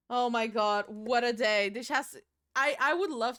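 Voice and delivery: loud, exasperated outburst; loud exclaiming. Real, not acted. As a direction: throw it out loud. Exclaiming loudly on stream.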